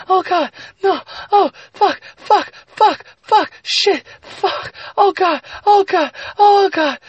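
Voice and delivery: very high, loud falsetto cries; frantic woman. Acted. Narrator voicing the woman nearing climax, frantic high cries. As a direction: yelp each word in a mock-female squeal.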